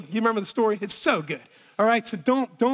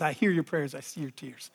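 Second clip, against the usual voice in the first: hushed reassurance; 8 semitones lower, 8 dB quieter than usual. Retold or imitated. God's word, hushed retelling.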